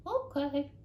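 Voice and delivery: high, eager little voice; happy squirrel. Acted. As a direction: chirp the answer. Squirrel agrees.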